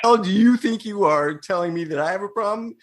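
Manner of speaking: high, affronted challenge; mock indignation. Defying those who say he has a problem, mock-indignant. Acting out friend's earlier defiance.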